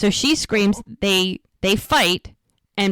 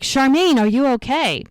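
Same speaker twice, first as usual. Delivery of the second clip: higher, louder, mildly alarmed check; retold alarmed call; 6 semitones higher, 4 dB louder than usual. Nurse finds her friend on the floor, retold alarmed call. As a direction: call out in mock concern.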